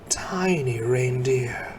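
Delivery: hush, as if the sight might vanish; awed whisper. A narrator's reading. Narrator whispering as the sleigh appears, awed. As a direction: whisper it in wonder.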